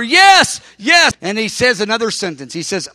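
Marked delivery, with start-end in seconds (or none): 0.0-1.1 high, loud cries of "yes"; crying out yes. Retold or imitated. Preacher reliving his answer to God, crying out loud. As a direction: cry out yes, overwhelmed. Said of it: "I was getting louder".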